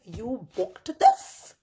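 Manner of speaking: slow, drawn-out disbelief; surprised question. Retold or imitated. Realizing he booked the patio, slow surprise. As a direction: stretch it out, amazed.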